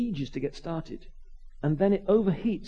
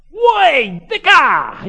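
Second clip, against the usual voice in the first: loud, high Cockney urchin call; Artful Dodger yell; 10 semitones higher, 13 dB louder, much slower than usual. Retold or imitated. Cockney kid hailing the vicar in his yard, urchin yell. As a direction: yell out like a cheeky street kid. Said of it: "Artful Dodger type".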